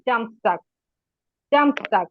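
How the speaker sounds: জামস্টাগ as German Samstag, zams-tahg; German Saturday. Shown as a demonstration. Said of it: "উচ্চারণ করে দেই".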